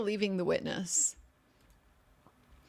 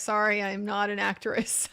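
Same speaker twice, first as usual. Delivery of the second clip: usual speaking voice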